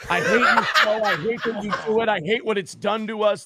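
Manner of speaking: mimicking her bitter complaint; retold complaint. Quoting the wife's bitter complaint, high and mocking.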